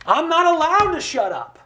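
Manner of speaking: high, emphatic imagined declaration; impassioned retold protest; Doctor King. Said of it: "I think Doctor King would say".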